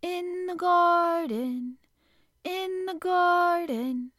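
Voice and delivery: high, slow, distant calling voice; a faraway voice calling from a dream. A narrator's reading. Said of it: "the voice which called back to him".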